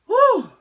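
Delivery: sharp high nervous blow of breath; nervous whew. Real, not acted. Vlogger bracing before a pregnancy test, nervous whew. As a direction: blow out the nerves before the result.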